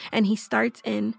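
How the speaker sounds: ordinary delivery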